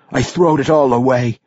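anguished dwarf outburst at his own folly; self-reproachful dwarf. A narrator's reading. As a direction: lament it bitterly as a rustic dwarf. Dwarf cursing his own choice.

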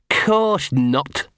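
drawn-out sarcasm from a talking dog; sarcastic denial. Acted. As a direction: deny it with heavy irony.

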